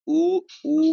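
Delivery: high, loud उ with rounded lips; rounded oo demo. Shown as a demonstration. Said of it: "होठ गोल होते हैं". (होठ गोल करके ऊँचा उ)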